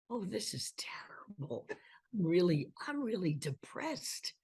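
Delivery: whispered mock despair. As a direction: moan it in hushed dismay. Dismayed reply retold.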